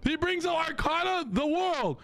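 can't-believe-it squeal; stunned high-pitched disbelief. Real, not acted. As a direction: voice jumps way up in shock. Shocked at opponent's play.

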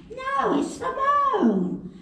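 high-pitched London twit insisting; London accent. Retold or imitated. Twit arguing with his mate, high London accent. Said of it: "arguing with each other".

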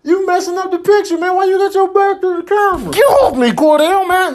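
high, loud shouting at a friend; yelling. Real, not acted. Yelling at Rudy blocking the camera, loud. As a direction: shout him off the shot.

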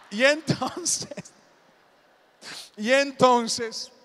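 loud, drawn-out storyteller's hook; teasing suspense. Pretend. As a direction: stretch it out slowly to tease eager listeners. Teasing crowd eager for gossip.

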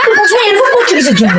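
fed up but can't stop laughing; giggly exasperated demand; laughing exasperation. Real, not acted.

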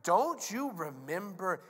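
slow, loud, drawn-out rebuke; stern, high-pitched booming frustration; exasperated Jesus. Acted. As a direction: hammer each word at slow-witted students. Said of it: "So he said".

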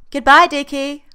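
loud, high call from another room; a sing-song goodbye called from the bathroom. Acted. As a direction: call it out across the flat. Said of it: "Freddy calls from the bathroom".

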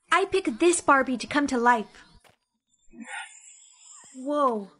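normal talking voice

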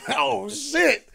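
sudden loud, high "oh shit"; shocked outburst. Retold or imitated. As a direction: blurt it out in disbelief. Realizing [name] is calling.

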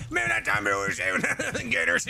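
high, garbled mock movie-character babble; Waterboy character impression. Streamer imitating a dude from Waterboy, garbled comic impression. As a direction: babble it high, breaking into laughter. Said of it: "What was the dude's name, uh, on, um, uh, Waterboy?".

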